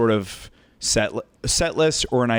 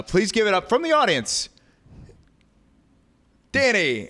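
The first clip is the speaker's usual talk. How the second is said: much higher, rising emcee call; stage introduction; 10 semitones higher than usual. Retold or imitated. Host bringing a heckler up on stage, mock introduction. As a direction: draw out the name like an emcee.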